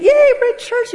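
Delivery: high, loud mock cheer; sweetly gleeful. Imitating her husband arriving at church, high gleeful cheer. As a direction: squeal it like an over-sweet enthusiast. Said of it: "Really sweet".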